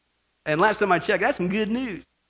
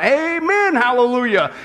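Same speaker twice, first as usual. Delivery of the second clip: mildly raised, high-starting amen; crying out praise; 7 semitones higher, 8 dB louder, slower than usual. Acted. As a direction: call it out like worshippers. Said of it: "and they cried out".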